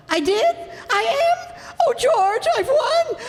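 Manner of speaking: high, near-shouting cries of joy; overjoyed. Acted. Wife tells husband she is a finalist, overjoyed. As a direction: squeal the news to your husband.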